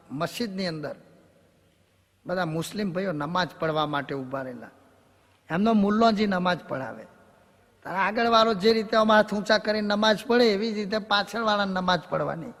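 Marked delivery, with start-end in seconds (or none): none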